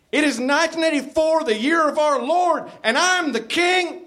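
high, fast mock-yelled declaration; grandiose proclamation. A narrator's reading. Narrator plays Jim declaring himself to Sam, high mock proclamation. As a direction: proclaim it high like a ranting preacher. Jim's yelled outburst continues.

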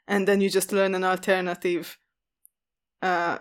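ordinary talk

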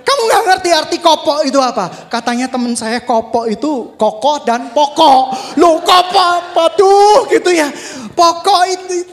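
loud, high scolding sermon delivery; fiery preaching. Acted. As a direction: rebuke the congregation at full force. Pengkhotbah menegur dengan keras. (khotbah berapi-api dengan suara keras dan tinggi)

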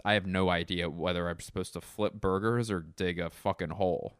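ordinary talk, nothing marked

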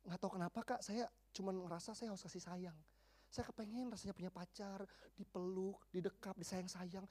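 low, hushed, acted admission; quiet imitation of a wistful girl; subdued confession. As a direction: softly confess a longing. Girl confessing longing for affection.

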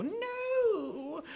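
very high, loud wail of protest; Rat King cry. Acted. Rat King reacting to being hit with a staff, high loud wail. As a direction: squeal it out as the hit comes. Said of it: "you hear the Rat King go".

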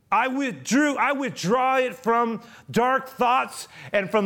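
high, loud, drawn-out declaration; solemn proclamation. A narrator's reading. Bishop buying Valjean's soul for God, solemn proclamation. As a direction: proclaim it slowly at full voice, like a blessing over him. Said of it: "he continued solemnly".

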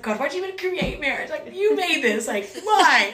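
high-pitched, exasperated rant at God; retold frustration. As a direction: demand an answer, building to a loud "why". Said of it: "I was just so mad".

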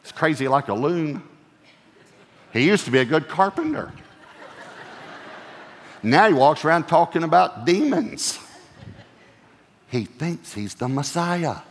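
mock family gossip, half-laughing; a lightly hammed-up gossiping relative; playful mock gossip about a crazy son; voicing gossiping family. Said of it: "let me bring that up modern day".

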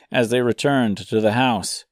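ordinary delivery, nothing marked

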